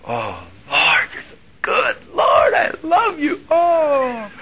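crying out thanks in delight; joyful praise. Retold or imitated. Preacher retells shouting praise to God, ecstatic.

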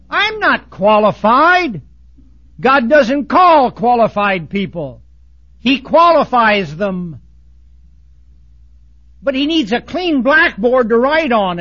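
loud, slow pulpit declaration; forceful preaching. Acted. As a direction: hammer each phrase out slowly. Preaches a point at full force.

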